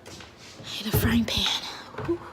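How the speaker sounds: slow whisper to herself; murmured to herself in the kitchen. Real, not acted. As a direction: breathe it out under her breath. Whispering while cooking.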